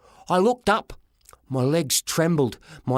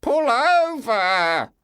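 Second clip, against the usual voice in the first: slow, higher other voice; stern Fred voice; 6 semitones higher, much slower than usual. A narrator's reading. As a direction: drag it out firmly. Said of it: "said a voice".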